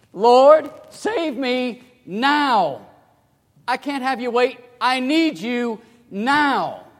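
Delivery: loud, high and drawn out plea; high, slow, loud desperate plea; urgent cry. Shown as a demonstration. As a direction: beg for help right now. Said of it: "the emphasis here is quickly".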